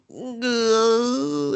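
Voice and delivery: a high strangled goat-like grunt; weird goat noise. Retold or imitated. The boy climaxing, a high goat-like grunt. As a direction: bleat it out like a goat. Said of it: "some fucking weird goat noise".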